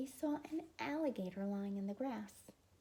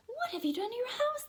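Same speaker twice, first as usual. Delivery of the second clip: high, loud shout; outraged shout; 8 dB louder than usual. Acted. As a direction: shout it at the neighbor. People shouted at the man.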